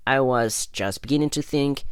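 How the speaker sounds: ordinary delivery, nothing marked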